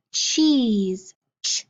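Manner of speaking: "cheese" then a short isolated "ch"; sound card drill. Shown as a demonstration. Teacher drilling sound cards with the class, first sound isolated. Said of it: "get ready for our sound cards".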